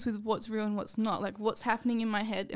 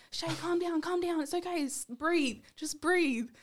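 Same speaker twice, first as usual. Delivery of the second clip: higher, softer soothing retold plea; mock reassuring; 6 semitones higher, 3 dB quieter, slower than usual. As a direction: gently talk a friend down, pitched up. Retells calming a laughing friend.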